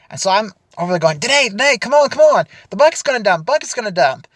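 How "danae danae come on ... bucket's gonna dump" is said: urgent yell to a friend under a dumping bucket; urgent calling out. Retold or imitated. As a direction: call her name to get her out of the way fast. Calling friend before bucket dumps.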